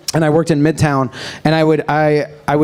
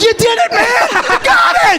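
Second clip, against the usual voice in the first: excited cheer. Retold or imitated. A passenger cheering the man who saved his phone. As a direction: cheer him on like a fan. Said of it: "someone was like".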